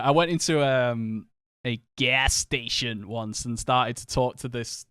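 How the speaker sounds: normal talking voice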